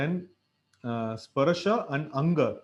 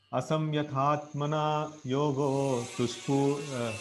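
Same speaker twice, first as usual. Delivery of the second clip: verse recitation. Acted.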